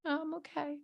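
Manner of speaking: imitated sad child, hushed; sad little girl. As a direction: say it softly, putting on a brave front. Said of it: "She was sad".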